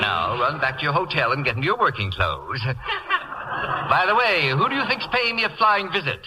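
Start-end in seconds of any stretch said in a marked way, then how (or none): 2.6-5.9 high cackling laugh that runs into the words; gleeful laugh. Acted. Whiteside teasing the actress about her rival, laughing. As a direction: laugh with delight before the news. Whiteside gloats over coming news.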